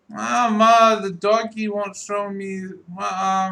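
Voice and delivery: put-on high, loud little boy calling his mother; toddler. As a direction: whine for mama like a two-year-old. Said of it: "He's like".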